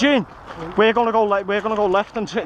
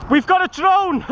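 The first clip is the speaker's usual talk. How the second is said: excited call; 10 semitones higher, 4 dB louder than usual. Real, not acted. Alert call in combat.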